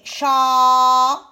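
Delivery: "chameau" loud and slow, long "cha", long "meau"; syllable-by-syllable "chameau"; split into syllables. Shown as a demonstration. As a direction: stretch both halves of "chameau". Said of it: "Je prends la première syllabe".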